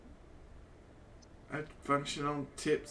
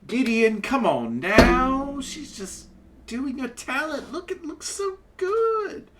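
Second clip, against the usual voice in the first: flamboyant half-elf; 9 dB louder than usual. Acted. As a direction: coax him, full of flair.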